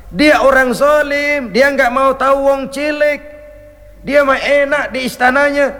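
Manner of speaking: a touch of a resentful widow; mild indignant complaint. Retold or imitated.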